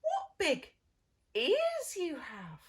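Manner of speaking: put-on very high, loud little girl; wondering girl. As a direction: marvel like a small child. Girl's famous line.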